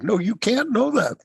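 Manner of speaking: high-pitched, playful agreement; mock emphatic concession. Man conceding to a smart frog, mock emphatic. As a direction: exclaim it with the pitch leaping up. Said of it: "says Mister".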